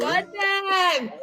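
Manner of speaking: high burst of glee; excited echo. Real, not acted. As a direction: squeal it back with delight. Echoing the son's phrase.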